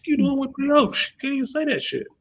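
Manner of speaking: amused high-pitched disbelief; high laughing outrage. Retold or imitated. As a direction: squeak out disbelief through laughter. Laughing mock outrage at kid.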